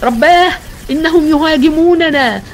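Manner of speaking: high, loud cry of alarm; urgent, panicked exclamation; tense alarmed shout. A narrator's reading. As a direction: shout a warning of sudden attack. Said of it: "وهو يهتف في توتر".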